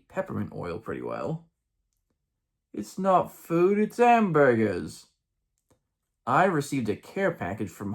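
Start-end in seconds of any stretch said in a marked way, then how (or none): 2.7-5.1 high, drawn-out mock British; mock British accent. Missionary protesting to his district leader, mock British accent. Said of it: "said with a mock British accent".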